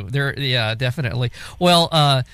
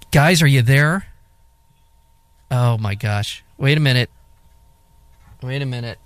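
from another room. Real, not acted. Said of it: "you sound like you're in another room".

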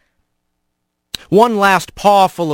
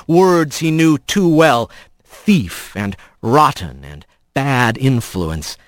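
ordinary delivery, nothing marked

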